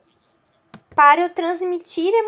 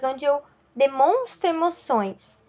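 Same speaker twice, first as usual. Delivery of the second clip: normal talking voice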